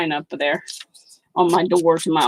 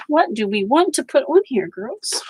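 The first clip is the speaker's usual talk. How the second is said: high sweet voice to the pets; playful pet talk; 7 semitones higher than usual. Real, not acted. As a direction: coo at the animals. Talking to pets.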